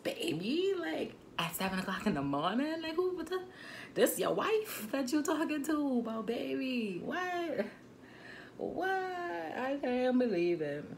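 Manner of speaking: shocked to the core; outraged disbelief. Real, not acted. Venting to viewers about the cheating, high outraged disbelief. Outraged at boyfriend calling girl baby.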